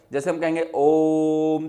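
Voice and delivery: ओम held out long and slow, triple length; prolonged om. Shown as a demonstration. Teacher demonstrating the long vowel in ओम to students, slow and drawn out. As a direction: stretch the vowel of ओम as a pluta sound. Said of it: "ओम बोलेंगे तो इसे तीन गुना time लगेगा".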